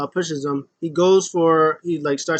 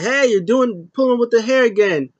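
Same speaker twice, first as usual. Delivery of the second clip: scolding ref; 7 semitones higher, 4 dB louder than usual. Retold or imitated. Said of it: "Ref is like".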